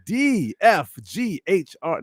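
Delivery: high, slow mock-announcer roll call; movie-phone voice. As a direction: swoop grandly on each letter. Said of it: "read it in your Claude voice".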